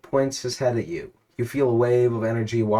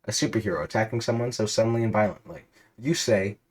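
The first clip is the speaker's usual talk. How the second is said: normal talking voice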